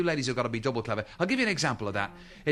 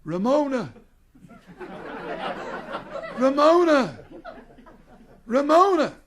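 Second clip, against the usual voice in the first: rising yells of a name, louder each time, calling out in a dream; calling in his sleep; 11 semitones higher, 6 dB louder, slower than usual. Acted. Drunk husband dreaming, calls out to Ramona, loud and building. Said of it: "talking in his sleep".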